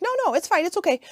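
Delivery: squeaky light reassurance; high mock-cheerful no, it's fine; high breezy okay. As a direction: toss it off high and chirpy. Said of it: "I'm like".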